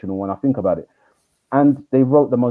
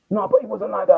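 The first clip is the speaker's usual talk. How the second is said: put-on protesting teen; 11 semitones higher than usual. Boy protests.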